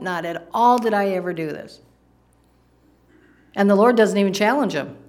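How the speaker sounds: usual speaking voice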